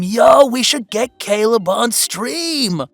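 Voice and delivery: loud, drawn-out playful call; rally the viewers with a stretched-out shout; excited hype. Acted. Hyping a sudden idea to viewers.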